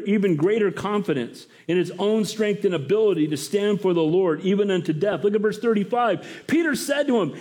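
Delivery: usual speaking voice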